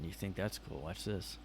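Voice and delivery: ordinary talk, nothing marked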